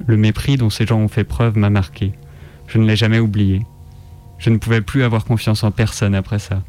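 usual speaking voice

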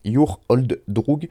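slow, drawn-out try at an American accent on the name; clumsy American accent. Shown as a demonstration. French radio host names the rapper, slow mock American accent. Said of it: "mon accent est trop pourri".

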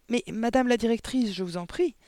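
anxious polite protest; pleading. A narrator's reading. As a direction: beg her to reconsider. Teacher pleads with headmistress.

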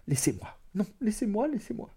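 high, faint, distressed pleading; tearful stammering. A narrator's reading. Woman struggling free of her lover, tearfully. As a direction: plead through tears, voice climbing then fading. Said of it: "les yeux pleins de larmes et balbutiant".

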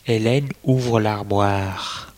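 usual speaking voice

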